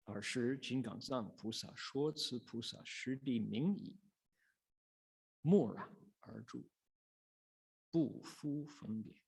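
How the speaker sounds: slow, measured Chinese sutra chant; sutra recitation. Acted. Teacher reads the Chinese sutra to listeners, slow chant. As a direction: intone each phrase slowly like scripture.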